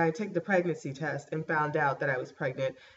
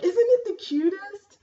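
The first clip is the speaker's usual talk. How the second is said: gushing; 13 semitones higher, 6 dB louder, slower than usual. Real, not acted. Showing off the baby frame.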